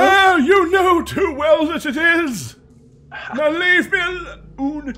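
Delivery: high, slow anguished shout; desperate shout. Acted. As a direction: yell him away in despair. Wizard NPC shouting through door.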